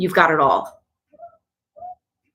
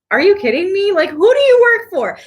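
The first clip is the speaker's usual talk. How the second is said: higher and louder, in disbelief; incredulous outrage; 11 semitones higher, 5 dB louder than usual. Real, not acted. Retorting to a commenter against salads, in outraged disbelief. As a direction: demand to know who they work for.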